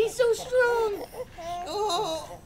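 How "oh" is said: ordinary delivery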